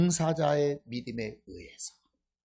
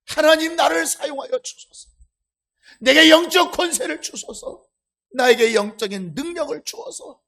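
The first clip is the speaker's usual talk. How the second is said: 부르짖듯 높고 크게 간구하기; 몸부림치며 하나님께 매달리는 기도; 애간장을 쏟는 기도; 6 semitones higher, 8 dB louder than usual. Acted. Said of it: "하나님께 애간장을 쏟아버리면". (힘껏 외치다 끝에서 잦아드는 간구)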